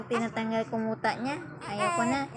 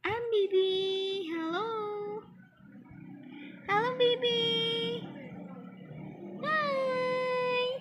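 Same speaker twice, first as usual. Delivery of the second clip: very high, drawn-out sing-song greeting; 12 semitones higher, slower than usual. Real, not acted. Mami saying hello to her baby, high cooing baby talk.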